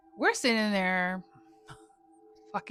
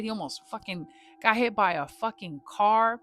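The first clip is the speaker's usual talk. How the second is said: ordinary talk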